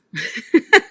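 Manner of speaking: big amused laugh; loud laugh. Real, not acted. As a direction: burst out laughing at your own line. Bursting into laughter mid-story.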